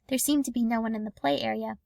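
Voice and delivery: ordinary delivery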